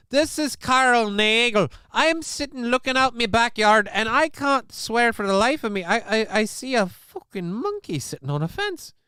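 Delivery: high, hammed-up Scottish accent; mock Scottish caller. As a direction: play a flustered Scottish caller reporting something absurd. Acts a Scottish emergency caller.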